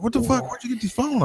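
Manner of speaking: the clerk can't believe it; high astonished question; amazed clerk. Retold or imitated. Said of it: "He was like".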